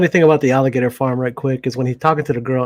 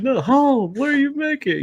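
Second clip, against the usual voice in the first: squeaky insistent correction; high mock voice; 13 semitones higher than usual. Correcting the misheard line in a high mock voice. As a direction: repeat the line much higher, insisting. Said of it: "He's like".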